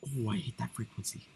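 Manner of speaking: ordinary delivery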